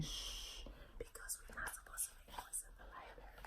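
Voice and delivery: soft hushing librarian whisper. A narrator's reading. Librarian hushing noisy animals, whispering. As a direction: breathe the words, no voice at all.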